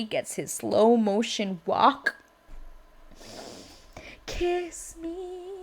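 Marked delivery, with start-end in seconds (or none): 4.3-5.5 high, soft, drawn-out croon; play-acted lovestruck plea; mock-romantic swoon. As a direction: coo it softly, high and dreamy. Playful mock-romantic quote during recap.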